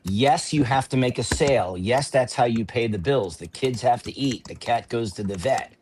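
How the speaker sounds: ordinary delivery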